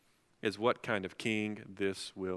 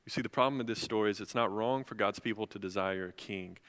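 usual speaking voice

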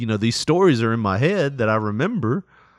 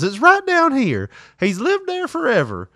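mom's voice; 13 semitones higher, 3 dB louder than usual. Retold or imitated. Mom voiced in story.

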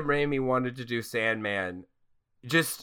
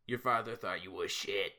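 low, much softer mock Tobey; bad Spider-Man voice; 6 semitones lower, 6 dB quieter than usual. Host mocks Spider-Man's angry lines in a low, soft voice. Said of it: "that's just my bad Spider-Man voice".

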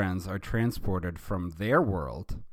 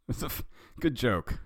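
plain reading voice

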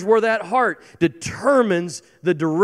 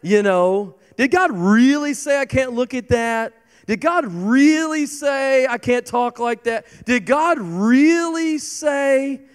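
higher-pitched, whining "Did God really say?"; whiny doubter; 6 semitones higher than usual. Acted. Preacher mimicking a believer questioning God, high and whiny. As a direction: whine like someone hunting for a loophole.

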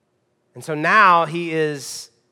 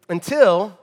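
usual speaking voice

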